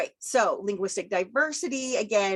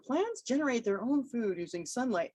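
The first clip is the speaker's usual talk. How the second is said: textbook voice; 4 semitones higher than usual. Retold or imitated. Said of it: "I mean, come on".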